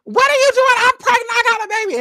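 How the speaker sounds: high, loud screaming protest; retold pregnant woman yelling at police, high and loud. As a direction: shriek it at the police. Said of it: "she's yelling like".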